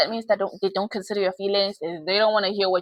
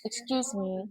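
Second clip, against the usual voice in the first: mock polite aside; 7 dB quieter than usual. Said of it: "now I have to go tell her".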